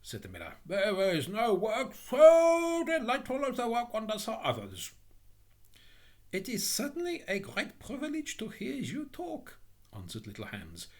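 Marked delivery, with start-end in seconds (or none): 0.7-4.9 high, loud, drawn-out moralising; gushing, pompous miller. Acted. 6.3-9.6 high, meek, humble voice; admiring Little Hans. Acted.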